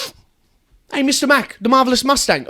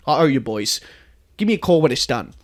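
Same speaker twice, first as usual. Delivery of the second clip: ordinary delivery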